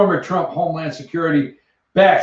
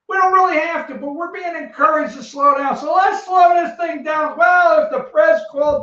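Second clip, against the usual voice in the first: mock postal boss; 7 semitones higher than usual. Postal boss voiced mockingly.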